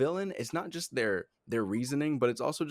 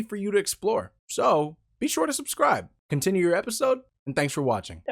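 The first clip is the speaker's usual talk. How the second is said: upbeat announcer read, raised pitch; promo read; 7 semitones higher, 4 dB louder than usual. Acted. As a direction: sell the channel like a cheery ad spot. Host reads a channel promo.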